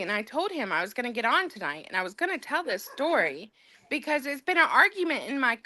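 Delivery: ordinary talk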